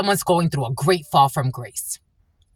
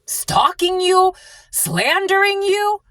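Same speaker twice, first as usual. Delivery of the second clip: high and slow, drawn out; 13 semitones higher, slower than usual. Real, not acted. Accusing the abusers, high and slow. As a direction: stretch each word up high.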